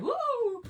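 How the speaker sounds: put-on sudden high loud whoop like an excited child; excited woo. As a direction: mimic his happy shout. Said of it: "every now and again you might hear".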